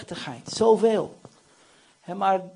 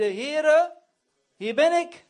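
high, ringing readiness; volunteer in a raised voice; wholehearted reply to a summons; eager answer; 7 semitones higher, 3 dB louder, much slower than usual. Acted. Isaiah answering God's call.

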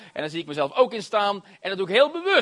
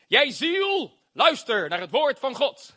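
higher-pitched, emphatic command; commanding own soul; 3 semitones higher, slower than usual. Acted. Preacher addressing his own soul, commanding. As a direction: order your soul to listen. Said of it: "En dan zeg ik".

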